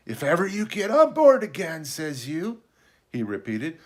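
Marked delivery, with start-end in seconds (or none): 0.1-2.6 high castaway voice, perking up slyly; startled slyness. A narrator's reading. Castaway repeating the boy's words, startled and sly. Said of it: "perked up in a kind of startled slyness".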